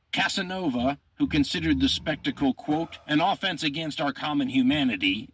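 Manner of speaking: emphatic, measured reading aloud; quote reading. A narrator's reading. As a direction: read the historian's words out with dramatic weight. Reads a quote from a historian.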